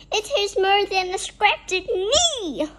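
high, loud storybook wail; screamed complaint. A narrator's reading. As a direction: cry out about the pain. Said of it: "he screamed".

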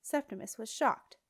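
plain reading voice

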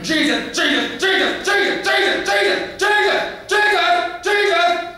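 very high, loud repeated cries, climbing; frantic shouting. Acted. Angry father calls on Jesus, frantic shouting. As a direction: cry out the name in a mounting frenzy.

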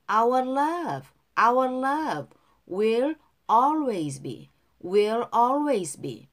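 slow, careful model reading for repetition; pronunciation model. Shown as a demonstration. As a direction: say each phrase slowly for learners to copy. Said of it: "후렴구 발음 따라할게요". (따라 읽도록 천천히 또박또박)

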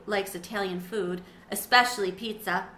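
ordinary delivery

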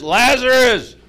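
loud, high commanding call; King James proclamation. Acted. Jesus calling the dead man out of the cave, grandly commanding. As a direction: boom it out like scripture. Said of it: "He did King James too".

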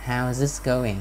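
"How's" linked on with a z; voiced Z ending. Shown as a demonstration. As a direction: keep "How's" voiced at the end in the phrase. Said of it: "thành z".

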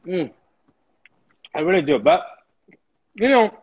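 talking while chewing; words round a bite of food; mouth full. Real, not acted. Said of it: "I shouldn't talk with my mouth full".

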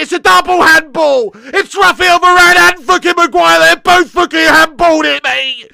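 loud, exasperated shouting; furious rant. Real, not acted. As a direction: rail at both defenders at full volume. Rages at two defenders' handball.

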